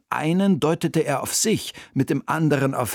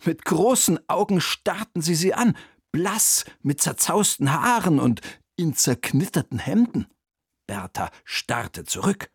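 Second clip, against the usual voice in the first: ordinary delivery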